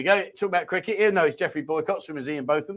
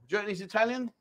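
ordinary talk, nothing marked

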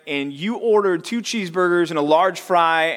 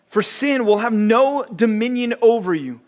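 a touch higher, measured scripture read; 4 semitones higher than usual. Acted. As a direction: read the verses with solemn weight. Reading scripture.

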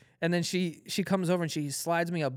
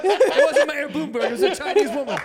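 laughing correction; 7 semitones higher, 4 dB louder than usual. Real, not acted. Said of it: "laughing correction".

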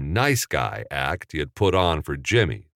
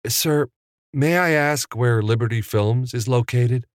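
higher, politely eager; nicest voice; 6 semitones higher than usual. A narrator's reading. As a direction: ask the star ever so sweetly. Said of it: "he asked in his nicest voice".

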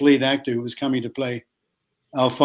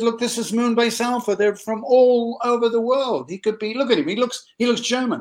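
high, insistent sales pitch, acted out; excited pitch; 9 semitones higher than usual. Director pitching an actor to producers, excitedly. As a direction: sell him eagerly in a raised voice.

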